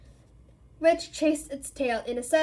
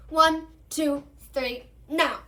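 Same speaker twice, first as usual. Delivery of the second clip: loud countdown; 3 semitones higher, 8 dB louder, slower than usual. A narrator's reading. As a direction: call out the count, louder. Lupin counts down the charm.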